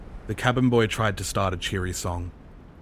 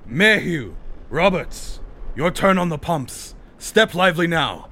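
high, loud shouted orders; bellowing boatswain; 10 semitones higher, 6 dB louder than usual. A narrator's reading. Boatswain ordering men to the pumps, bellowing. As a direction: bellow it down into the cabin. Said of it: "the boatswain's voice bellowed into the cabin".

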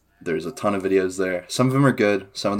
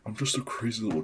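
light quick, soft smug voice; Discord mod voice; 4 semitones higher, 8 dB quieter, faster than usual. Retold or imitated. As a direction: play the smug little mod. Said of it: "the Discord mod voice".

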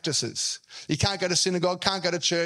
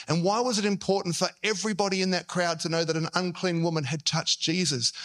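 ordinary talk

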